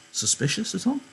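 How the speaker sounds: ordinary talk, nothing marked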